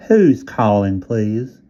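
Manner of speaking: whiny Southern operator, repeated; nasal Southern operator voice; Southern accent. A narrator's reading. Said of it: "Southern accent, nasal and whiny".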